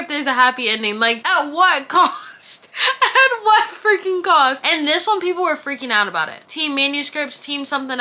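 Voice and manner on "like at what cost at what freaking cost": high, loud, slow, laughing-crying exasperation; exasperated rant. Real, not acted. Vlogger rants about sad Colleen Hoover books, laughing exasperation. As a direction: wail it, half laughing, half sobbing.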